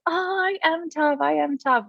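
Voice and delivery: high, slow breezy brag; mock the client's easy confidence; overconfident clients. Clients laughing off the warning, overconfident and high. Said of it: "they're just like".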